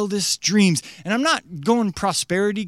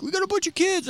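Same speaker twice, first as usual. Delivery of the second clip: mock parent; 10 semitones higher, 5 dB quieter, faster than usual. Voicing a family in a story.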